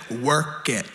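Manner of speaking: "Work it" low and sassy, like on a runway; sassy attitude. Shown as a demonstration. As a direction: strut it out like a fashion show. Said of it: "Say it with a little bit of attitude".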